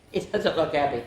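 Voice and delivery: ordinary delivery, nothing marked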